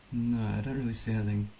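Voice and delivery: ordinary talk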